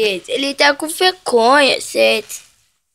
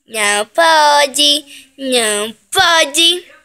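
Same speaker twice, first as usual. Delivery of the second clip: drawn-out, louder "não pode"; slow scolding; 5 dB louder, much slower than usual. Real, not acted. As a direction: scold the pet, stretching each word. Scolding pet slowly.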